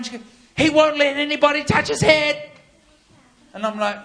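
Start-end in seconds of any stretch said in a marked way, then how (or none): 0.6-2.5 high, loud mock wail; imitated crying mum. Boy's mum protesting to preacher, mock-tearful and loud. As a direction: exclaim it like an overwrought mum. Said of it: "His mum starts crying".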